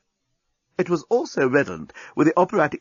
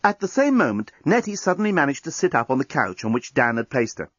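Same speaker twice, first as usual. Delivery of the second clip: plain reading voice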